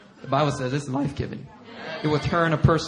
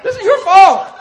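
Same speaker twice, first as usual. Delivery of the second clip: high, loud mock berating; mean scolding; 9 dB louder than usual. Boss berating crying employee, mean mock yell. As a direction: yell the blame, hammed-up exasperation. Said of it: "I've been so mean with Mina".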